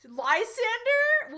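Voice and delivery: high, slow, drawn-out shocked cry; stunned disbelief at the name; incredulous drawn-out name; shocked gasp of a name. Real, not acted.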